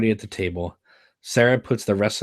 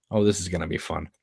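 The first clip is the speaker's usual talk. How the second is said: ordinary delivery, nothing marked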